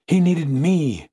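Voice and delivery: slow, high anguished insistence; intensified grief. A narrator's reading. Fiancée insisting the dead man needed her, anguished.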